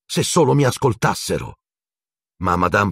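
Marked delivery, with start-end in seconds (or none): 0.1-1.6 high-pitched exasperated complaint; furious. A narrator's reading. Harry complaining nobody listens, furious. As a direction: protest in frustration. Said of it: "ribatté Harry furibondo".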